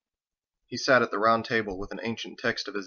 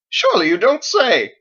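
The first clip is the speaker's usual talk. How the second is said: very high, loud pompous exclamation; mock offended cry; 12 semitones higher, 11 dB louder than usual. A narrator's reading. Harold feigning offence at Thomas, loud hammed-up cry. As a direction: recoil in hammed-up outrage. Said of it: "Harold recoiled".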